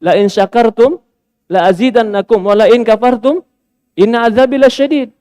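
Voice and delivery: preacher quoting a Quranic verse in Arabic; Quran recitation. Real, not acted. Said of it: "Allah berfirman dalam Al-Quran".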